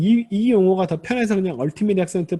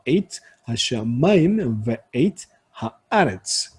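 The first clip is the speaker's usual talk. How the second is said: slow, lower reading, "하샤마임" on 마, "하아레츠" on 아; wave-like stressed reading; 5 semitones lower, slower than usual. Shown as a demonstration. Teacher modelling the verse's rhythm, slow with late stresses. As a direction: pause between words and lean on each stressed syllable. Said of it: "이런 식으로".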